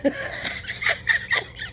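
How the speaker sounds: high breathy shriek of laughter. Real, not acted. Laughs at her own rambling.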